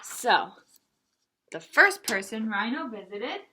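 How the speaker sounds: ordinary delivery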